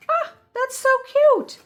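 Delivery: imitating a gushing recipient; gushing delight. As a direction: squeal over how cute the card is. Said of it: "And then you go".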